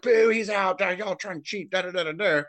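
high jeering boo; mocking the crowd. Mimicking the rival fans heckling. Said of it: "they're all like".

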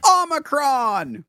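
long, high drawn-out shout; mock audience yell, high and slow; calling out. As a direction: holler it from the crowd, stretching it. Audience shouting an improv suggestion.

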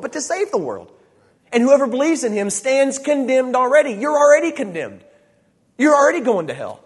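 high, loud, emphatic preacher; full-force preaching. Real, not acted. Preacher telling listeners they are already condemned, full-force preaching. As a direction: drive it home to the congregation, high and loud.

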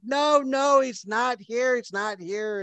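high voice, softening as it goes; other woman's voice. Acted. Second woman calming the enraged one, high and soothing. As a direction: plead it high, then ease down. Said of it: "the other woman's voice".

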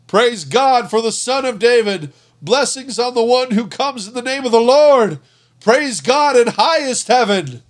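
high, loud jubilant cheering; shouting praise. A narrator's reading. Crowd hailing Jesus in procession, high loud cheer. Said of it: "the people all around him were shouting".

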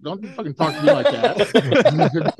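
slow, laughing mock scold. Retelling scolding the little boy, laughing.

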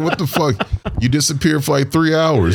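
high-pitched retold complaint with some laughter; mock indignation. Man replaying his quarrel with his ex, mock indignant. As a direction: protest high, half laughing.

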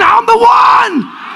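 high, drawn-out preacher's shout; exultant scream. Real, not acted. Preacher leading the congregation's declaration, high scream. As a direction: scream it out for the crowd to repeat. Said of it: "Somebody scream again".

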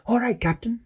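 high eager sidekick; Peso's voice. Acted. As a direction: pipe up ready for action. Peso answers.

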